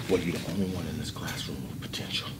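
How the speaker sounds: normal talking voice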